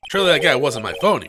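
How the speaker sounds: ordinary talk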